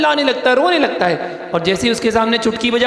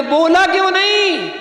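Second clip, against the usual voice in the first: scolding; 8 semitones higher than usual. Acted. Master scolds the dog.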